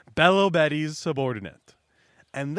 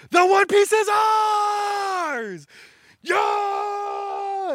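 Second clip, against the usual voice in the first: much higher, slow, full-throated roar; triumphant shout; 5 dB louder, much slower than usual. Retold or imitated. Buggy roaring to his cheering crowd. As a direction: roar it like you already own the treasure. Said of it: "And then he yells".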